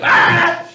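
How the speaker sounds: high loud mock scream; jump scare scream. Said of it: "they were like".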